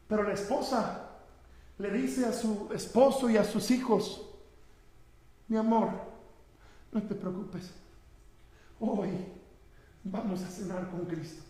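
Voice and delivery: very soft and low, with long pauses; almost murmur the last comfort; hushed tender retelling. Retold or imitated. Preacher voices a doomed wife reassuring her family, hushed.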